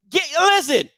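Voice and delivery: ordinary talk, nothing marked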